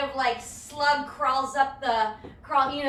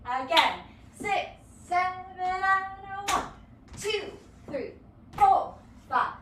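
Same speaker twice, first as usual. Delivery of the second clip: dance count-in; 3 semitones higher, slower than usual. Shown as a demonstration. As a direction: count the class in with a driving beat. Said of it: "Let's do a whole eight".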